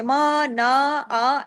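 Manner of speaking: "मा, ना, आ" each dragged out long; long open "aa" on each of "मा, ना, आ"; wrongly lengthened vowels. Shown as a demonstration. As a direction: stretch every vowel of "मा, ना, आ" like an added alif. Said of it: "ऐसे नहीं करना".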